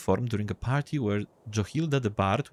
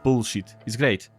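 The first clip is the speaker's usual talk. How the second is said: ordinary talk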